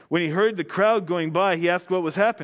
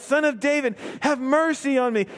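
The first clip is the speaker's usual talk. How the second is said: crying out; 6 semitones higher than usual. Acted. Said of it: "he cried out".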